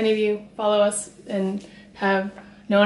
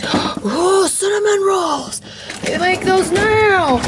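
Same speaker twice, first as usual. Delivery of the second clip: high, drawn-out delight; gasp, then coo it up high, slowly; excited; 9 semitones higher, much slower than usual. Real, not acted. Discovering cinnamon rolls in the fridge, high-pitched delight.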